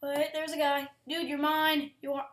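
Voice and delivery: normal talking voice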